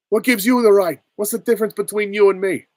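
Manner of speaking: loud, high-pitched indignant film line; outraged vigilante impostor calling out; acted challenge. Requested film impression, acted challenge.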